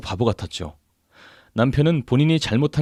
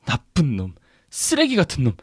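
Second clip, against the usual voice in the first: bitter cursing; 3 semitones higher than usual. A narrator's reading. Betrayed wife cursing her husband, bitter. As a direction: curse him in bitter outrage.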